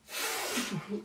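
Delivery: long breathy sigh of frustration; annoyed sigh. Real, not acted. Vlogger sighing over a bad online order, fed-up sigh.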